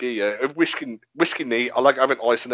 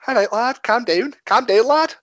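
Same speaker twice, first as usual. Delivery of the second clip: high-pitched, hammed-up Scouse; Scouse accent; 7 semitones higher, slower than usual. Answering the co-host in mock Scouse. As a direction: lift it into a comic Scouse lilt. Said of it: "No, it's Scouse".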